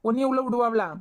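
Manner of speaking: urgent insistence. Real, not acted. Husband urging his wife to hide the phone, loud and impatient. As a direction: press her hard to do it now.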